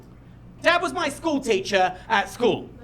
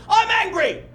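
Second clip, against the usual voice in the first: high, loud mock scream; screaming his feelings; 11 semitones higher, 7 dB louder, much slower than usual. Farmer dad screams feelings instead of swearing, hammed-up scream. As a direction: bellow each feeling like a declaration. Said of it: "he just screams his feelings".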